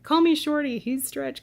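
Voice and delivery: lightly voiced teenage me; mock girlish quote. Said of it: "it'd be like".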